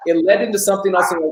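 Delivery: ordinary delivery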